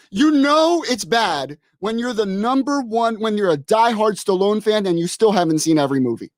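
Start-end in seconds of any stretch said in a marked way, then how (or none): none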